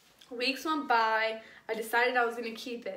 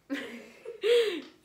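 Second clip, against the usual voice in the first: short laugh; 5 semitones higher, 4 dB louder than usual. Real, not acted. Laughing at the memory.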